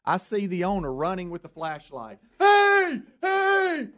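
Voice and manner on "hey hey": high-pitched distant yell, words stretched out; mock scream. Owner running up with a flashlight, retold scream. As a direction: holler it high, imitating the frantic owner.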